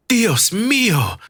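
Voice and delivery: high, drawn-out cry of alarm; narrator's restrained scream; mock scream. A narrator's reading. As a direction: scream it high and slow without full volume. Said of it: "she screamed".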